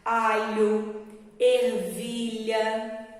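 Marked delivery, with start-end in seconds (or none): 1.4-3.1 "ervilha" stretched out with a clear lh; teacher modelling a word; slow example word. Shown as a demonstration. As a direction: say "ervilha" slowly, lingering on lh. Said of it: "palavrinhas com L e H".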